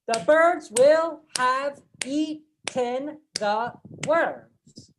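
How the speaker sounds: slow, separated syllables, each equally weighted; labored word-by-word delivery, no reductions; every word stressed. Shown as a demonstration. Teacher showing how not to say it, slow over-stressed syllables. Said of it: "we are not saying".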